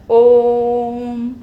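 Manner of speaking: om held long, three times a short vowel; ओम sung out long and steady; drawn-out om. Shown as a demonstration. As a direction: stretch ओम into a long held chant. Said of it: "लंबा उच्चारित करते हैं".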